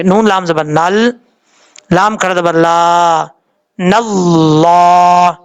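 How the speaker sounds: slow syllable-by-syllable spelling, then joined; spelled-out recitation. Shown as a demonstration. Teacher spelling out the joined letters for students, slow drawn-out recitation. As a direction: spell it slowly, ending on a long, held نَاللَّهِ. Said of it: "اس طریقے سے پڑھنا ہے".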